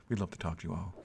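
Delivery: hushed radio-style welcome; ASMR voice. Pretend. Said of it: "our ASMR voices".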